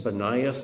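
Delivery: Banias as buh-NYE-us, rising at the end; third pronunciation variant. Shown as a demonstration. Teacher unsure of a place name, trying Banias as buh-NYE-us. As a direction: say Banias with a long "eye" in the middle. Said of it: "not sure how it's pronounced".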